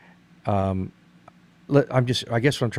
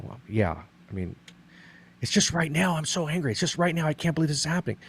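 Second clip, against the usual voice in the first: higher, strained voice of a frustrated friend; imitating frustrated man; 7 semitones higher than usual. Counselor voicing a frustrated man to the listener, higher and strained. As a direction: voice him fed up but coping. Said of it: "he's gonna go".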